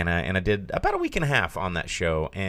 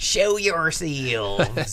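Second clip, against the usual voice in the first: high, very drawn-out mock Southern call; hammed-up Southern movie holler; bad Southern accent; 8 semitones higher, much slower than usual. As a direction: stretch it into an absurd Southern drawl. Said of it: "Oh, this is Southern people".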